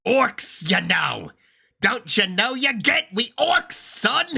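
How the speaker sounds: high, hammed-up attempt at Cockney; bad Cockney accent. Viewer imitating orcs in the video, bad high Cockney. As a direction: do a botched, squawky Cockney orc. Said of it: "I cannot do a Cockney accent well".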